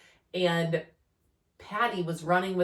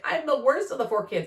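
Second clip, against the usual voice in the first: whiny son; 8 semitones higher, 4 dB louder than usual. Retold or imitated. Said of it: "he was like".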